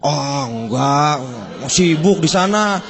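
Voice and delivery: slow, drawn-out humble answer; a customer playing down his job; modest reply. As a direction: brush off the praise modestly. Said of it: "gimana ngomongnya".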